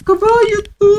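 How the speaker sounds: high, loud surprised exclamation; surprised shout. Real, not acted. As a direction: blurt it out in shock. Exclaiming at seeing a horse.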